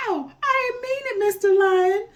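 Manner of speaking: very high, loud squeal of a caught monkey; monkey hollering. A narrator's reading. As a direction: squeal in panic, pleading. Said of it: "Monkey hollered".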